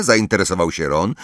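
ordinary delivery

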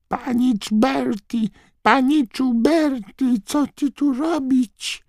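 very high, loud, slow squeaky elf voice; squawking house-elf. A narrator's reading. House-elf Mrużka crying out to her young master, loud squawk. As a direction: screech like a frantic little house-elf. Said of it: "zaskrzeczała donośnie".

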